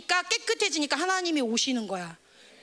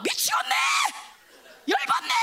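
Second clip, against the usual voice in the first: very high, exasperated screech; hammed-up furious shriek; maddened fire. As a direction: scream like fire losing its temper. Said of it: "막 불이 막".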